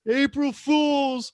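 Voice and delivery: high, slow, drawn-out teasing; teasing big brother. Retold or imitated. Big brother springs the prank on the crying kid, slow high teasing. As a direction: stretch the punchline out like a gloating older brother. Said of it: "his big brother goes".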